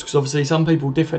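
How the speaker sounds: normal talking voice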